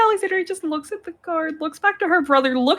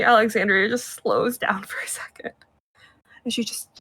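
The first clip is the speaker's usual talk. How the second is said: usual speaking voice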